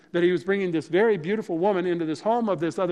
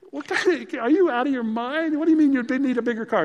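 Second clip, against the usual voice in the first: higher, louder incredulous outburst; wife can't believe what she hears, pitched up and loud; disbelieving wife; 8 semitones higher, 5 dB louder than usual. Retold or imitated. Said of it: "she couldn't even believe I was talking like this".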